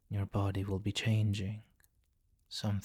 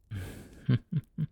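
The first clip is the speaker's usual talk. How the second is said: dark chuckle; 3 semitones higher than usual. Acted. Vampire king to his nervous human, a dark amused chuckle.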